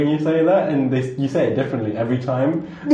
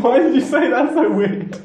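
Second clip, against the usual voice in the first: teasing disbelief; 8 semitones higher than usual. Real, not acted. Teasing reaction to odd delivery.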